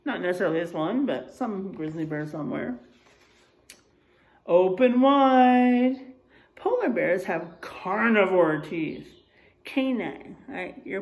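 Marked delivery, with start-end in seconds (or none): 4.5-6.2 slow, loud, drawn-out open wide; playful open wide. Pretend. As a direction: ham it up like a dentist for the kids. Teacher acts out a bear's mouth.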